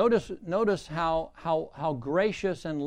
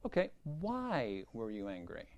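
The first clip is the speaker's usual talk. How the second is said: low, soft counseling question; gently ask, pitched down and quiet; hushed, searching question; probing counselor; 6 semitones lower, 10 dB quieter than usual. Acted. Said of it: "what you really need to do is say".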